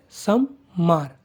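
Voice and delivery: सम्मार very slow with a long doubled m and a falling end; slow pronunciation demo. Shown as a demonstration. Teacher repeating Monday for students, very slow. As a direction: drag सम्मार out for learners. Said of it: "मेरी pronunciation पर ध्यान दीजिएगा".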